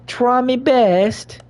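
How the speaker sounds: high, slow mock British "Try me best."; hammy British put-on voice; mock British accent. Shown as a demonstration. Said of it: "repeats in put-on voice".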